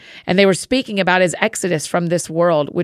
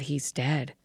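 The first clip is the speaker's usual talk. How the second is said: hushed murmur, much softer; 3 semitones lower, 12 dB quieter than usual. A narrator's reading. People in the crowd about the boy, murmuring. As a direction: drop it to a low murmur. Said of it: "A murmur ran through the crowd".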